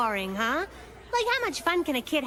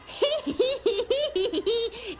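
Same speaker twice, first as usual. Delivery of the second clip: high goofy giggling; silly giggle; 8 semitones higher, slower than usual. Retold or imitated. Boy hanging around her giggling high and silly. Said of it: "he hangs around you going".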